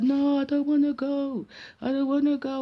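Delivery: high-pitched imitation of a reluctant little boy; protesting small child. Imitating nephew who won't leave the aunt, high protest. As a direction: whine it like a toddler digging in.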